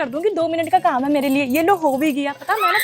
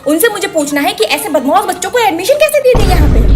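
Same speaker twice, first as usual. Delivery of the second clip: angry mother; 3 semitones higher, 6 dB louder than usual. Acted. Angry mother confronts teacher.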